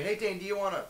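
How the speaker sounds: chirpy imitation of the friend asking; lightly voiced buddy; quoting a friend. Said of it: "He's like".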